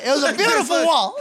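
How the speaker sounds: high, giggling imitation of a proud dad; mock-proud dad, laughing. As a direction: laugh through the dad's smug line. Mocking dad admiring the wall.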